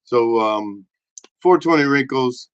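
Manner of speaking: normal talking voice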